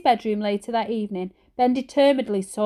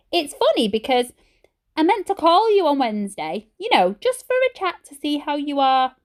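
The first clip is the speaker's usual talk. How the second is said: high, falsely bright mum voice; lying breezily to granny; fake cheerful mum; 6 semitones higher than usual. A narrator's reading.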